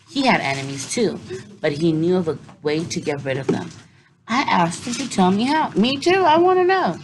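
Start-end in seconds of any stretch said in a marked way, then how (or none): 5.7-7.0 put-on high, loud eager kid voice; eager child. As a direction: beg to know like a kid. Eager kid listener interjects.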